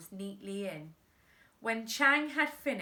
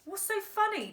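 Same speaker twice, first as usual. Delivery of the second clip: high, sharp demand; boy demanding why they laugh; 11 semitones higher than usual. Acted. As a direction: demand an answer, pitched up. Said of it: "demanded the boy".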